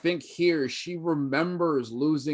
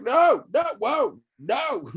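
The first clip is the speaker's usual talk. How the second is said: high, loud panicked no's; mock-panicked director yelling no; panicked shout; 12 semitones higher, 6 dB louder, slower than usual. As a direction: yelp it like a man with guns on him. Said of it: "Hayward's like".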